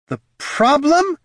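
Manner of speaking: high, loud, drawn-out outrage; angry snarl. A narrator's reading. Batel's shade answers the head's remark, snarling. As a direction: throw it back furiously, slow and high. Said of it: "she snarled".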